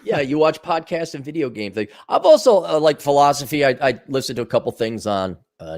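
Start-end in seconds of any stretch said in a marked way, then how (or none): none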